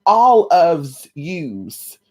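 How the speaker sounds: slow, stretched New Jersey "All of yous" with a final z; drawn-out New Jersey plural "yous". Shown as a demonstration. Speaker tries the New Jersey way of saying "all of yous". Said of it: "Don't they say that in New Jersey?".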